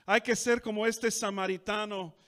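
loud, driving Spanish pulpit preaching; fervent preaching. Acted. Pastor exhorting the congregation in Spanish, fervent and loud. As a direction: press the church to return and give thanks.

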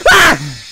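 short loud yelp of fright; startled scream. Real, not acted. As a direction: scream out at a jump scare. Jump scare.

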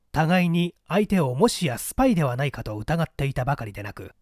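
usual speaking voice

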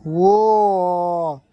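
a loud startled whoa from Rex. A narrator's reading. Rex cries out as the king charges his friends, loud startled whoa. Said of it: "cried Rex".

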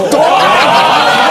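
mock drum hit. Comic punchline sound effect.

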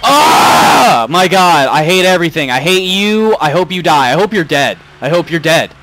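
raging shout. Real, not acted. Streamer cursing a cop chasing him, raging shout. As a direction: yell your hatred at the screen.

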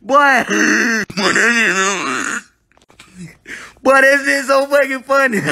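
high, breathless laughing voice; giggling while talking. Real, not acted. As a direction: squeal through the laughter. Laughing hard at a video.

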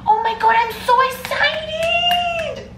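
very high, loud mock-excited shriek; hammed-up giddy screech; imitated excited squeal. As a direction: squeal it like a famous clip, hugely drawn out at the end. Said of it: "The way the person goes".

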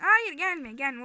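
loud high shout of no; panicked loud yell. Real, not acted. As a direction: yell at the screen to stop her. Shouting at game character.

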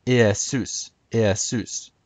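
Iesus said ih-eh-SOOS; classical Greek pronunciation. Shown as a demonstration. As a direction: say Iesus with open eh, accent on the end. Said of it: "Jesus would have been pronounced".

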